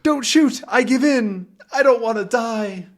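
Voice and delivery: high-pitched acted panic; scared pleading. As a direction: beg for life in a high voice. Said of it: "scares Ivan".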